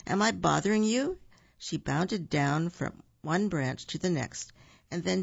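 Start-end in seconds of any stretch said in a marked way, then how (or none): 0.1-1.2 a higher, perky squirrel. Acted. Shirl Squirrel apologizes to Buford, lightly perky. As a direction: bounce it like a chirpy squirrel.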